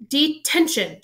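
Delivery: high, loud sharp shout. A narrator's reading. McGonagall shouting at Malfoy, high and loud. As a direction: shout it at the caught pupil. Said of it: "she shouted".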